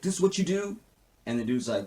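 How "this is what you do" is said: hurt accusation. Retold or imitated. Wyatt thinks Anna is calling.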